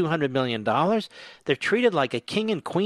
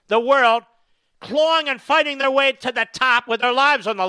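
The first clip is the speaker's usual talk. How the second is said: much higher and louder, a furious tirade; angry rant; 9 semitones higher, 4 dB louder than usual. Real, not acted. Radio host raging at the mail-in ballots, furious shout. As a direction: shout it down like you can't believe it.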